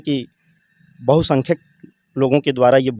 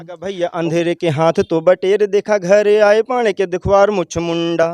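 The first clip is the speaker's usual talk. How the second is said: poem recitation; 7 semitones higher, 4 dB louder than usual. Acted. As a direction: belt out the satirical verse like a stage poet. Opening verse recited in dialect.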